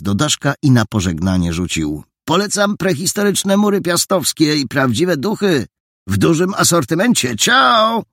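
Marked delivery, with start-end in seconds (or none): 2.2-8.0 cheeky farewell. Acted. Boy recommends the castle ghosts, higher and jaunty. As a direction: show off then toss out a parting ciao.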